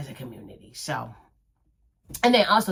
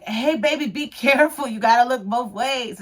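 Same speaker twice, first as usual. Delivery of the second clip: put-on high, raised mother's warning to a kid; motherly warning; 7 semitones higher, 5 dB louder than usual. Warning the boy on the scooter, high and raised. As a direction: call out to him like a worried mom. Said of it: "I'm like".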